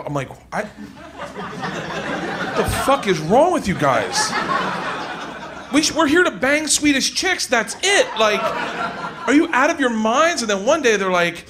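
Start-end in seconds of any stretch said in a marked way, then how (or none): 0.5-10.4 exasperated disbelief. Retold or imitated. Exasperated reply to nerdy friends.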